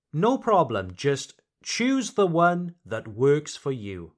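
normal talking voice

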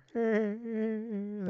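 half-hearted cartoon laugh, pitched high; Peter Griffin laugh. Shown as a demonstration. Podcaster showing the only impression he can do, half-assed cartoon laugh. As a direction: do a half-assed put-on chuckle. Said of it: "a half-assed Peter Griffin laugh".

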